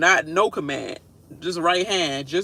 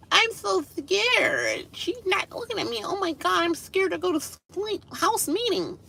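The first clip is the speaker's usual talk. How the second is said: victim noise; 13 semitones higher, 3 dB quieter than usual. Retold or imitated. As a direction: whimper like a helpless fake victim. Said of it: "freaking victim noise".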